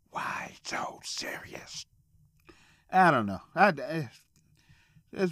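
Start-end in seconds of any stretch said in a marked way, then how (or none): 0.1-1.8 slow menacing whisper; villain line, whispered slowly; eerie whisper. Retold or imitated. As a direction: breathe it out slow like a creepy villain. Quoting a movie villain line.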